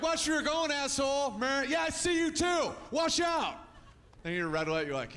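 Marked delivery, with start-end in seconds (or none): 0.0-3.6 higher, tense yelling at another driver; road rage yell. Acted. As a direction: shout at the driver in traffic.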